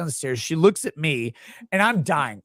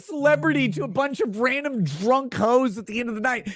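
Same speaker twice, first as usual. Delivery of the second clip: mock exasperated scolding; 8 semitones higher than usual. Husband scolding offended girl at the bar, exasperated. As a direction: tell her off in disbelief.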